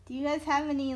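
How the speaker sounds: ordinary delivery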